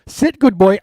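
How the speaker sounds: acted dog command. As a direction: give the dog a brisk mock command. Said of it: "if somebody said sit".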